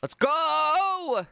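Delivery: high, drawn-out celebratory yell; excited streamer cheer; hyped cheer. Real, not acted. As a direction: whoop it out, stretching the last word. Hyped cheer during gameplay.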